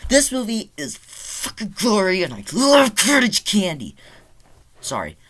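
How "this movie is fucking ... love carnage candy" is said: high-pitched outburst, building louder; over-the-top gushing. Real, not acted. Gore hound raving about carnage candy, high excited outburst. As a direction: let the glee explode, high and growing louder.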